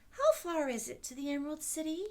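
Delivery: put-on eager young Dorothy asking the way; light girlish voice asking; little girl. A narrator's reading. Girl's lines voiced by reader.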